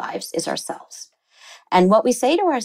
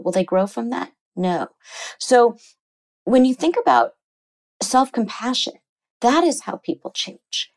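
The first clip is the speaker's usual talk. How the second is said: usual speaking voice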